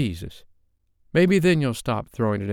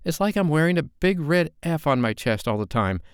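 put-on higher, louder young woman flaring up; rising temper; 9 semitones higher, 4 dB louder than usual. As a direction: let her temper boil over, pitched up. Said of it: "Her voice rose with his as her temper flared".

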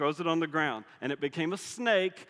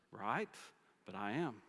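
soft, lower, settled; quiet answer; 5 semitones lower, 8 dB quieter than usual. Retold or imitated. God calmly answering Moses's excuses, softer and lower. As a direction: answer simply, almost gently.

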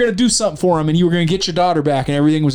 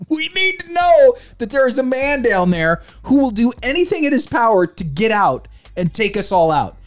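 ordinary talk, nothing marked

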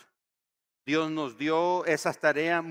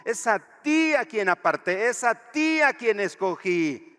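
high, loud commanding voice; God's reply; 7 semitones higher, 9 dB louder than usual. Acted. God telling the listener, loudly, it is them. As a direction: speak as God choosing you. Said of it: "Y Dios dice".

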